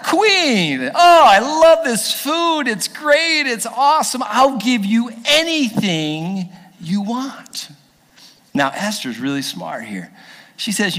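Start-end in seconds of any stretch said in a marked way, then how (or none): 0.0-7.7 put-on high, loud, drawn-out drunken gushing; tipsy happy king. Tipsy king praising the queen's feast, gushing. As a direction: gush like a happy drunk, stretching the words. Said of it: "He's a little tipsy".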